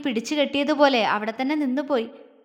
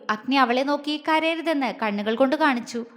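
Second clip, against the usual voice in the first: ordinary delivery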